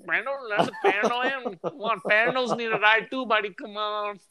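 high, put-on Indian uncle accent, teasing; mock Indian accent. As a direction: mimic a desi uncle scolding a buddy, high-pitched. Continues the uncle impression.

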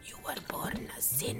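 whispered mock condemnation; crazy religious people. Imitating religious people condemning a child, mock-ominous whisper. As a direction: rasp it in a spooky whisper, making fun. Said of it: "the crazy religious people".

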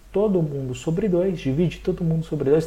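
normal talking voice

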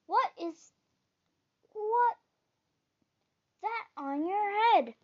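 king baffled by the hat; astonished king. A narrator's reading. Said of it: "demanded the king".

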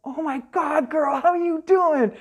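high, loud, thrilled hello; excited greeting. Retold or imitated. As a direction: gush over a friend you haven't seen. Greeting a friend warmly.

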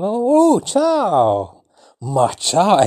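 put-on light up when you see an old friend; delighted ciao. Shown as a demonstration. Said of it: "Oh, yeah".

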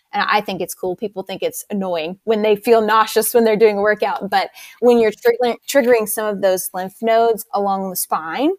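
ordinary delivery

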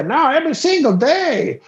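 high, emphatic mock local; high-pitched boastful imitation; voiced party host.

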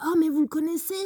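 high, surprised character voice. Retold or imitated. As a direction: play the surprised kid. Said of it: "puis il dit". (voix aiguë de personnage)

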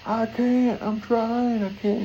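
high, strained I can't; a kid struggling in the water; kid crying out. Retold or imitated. Drowning kid answering his dad, high and desperate.

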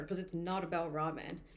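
ordinary talk, nothing marked

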